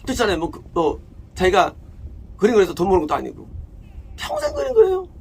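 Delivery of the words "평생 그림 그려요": 평생 shouted much higher and louder; exclaimed lifelong. Real, not acted. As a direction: cry out the wonder of painting for a lifetime. Preacher exclaims about lifelong painting. (평생을 높게 외치듯)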